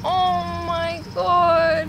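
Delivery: high, very drawn-out wail of sympathy; dismayed pity. Real, not acted. Hearing the cat was a long-time stray, dismayed pity. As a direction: stretch it out high, aching for the poor cat.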